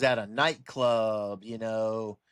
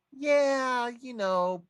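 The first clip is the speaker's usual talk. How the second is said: very high, drawn-out cartoon frog voice; persuaded frog; 12 semitones higher, 3 dB louder, much slower than usual. Frog talked into giving a scorpion a ride, high-voiced and yielding. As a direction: give in, high and stretched out. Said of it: "Frog says".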